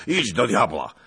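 put-on explosive old noble's temper; sudden anger. A narrator's reading. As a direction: send them packing at full voice. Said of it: "z nagłym gniewem".

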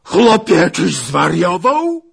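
high, very loud incredulous yell. Acted. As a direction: scream it while shaking the lad. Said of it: "wrzasnął pan Zagloba". (wściekły wrzask)

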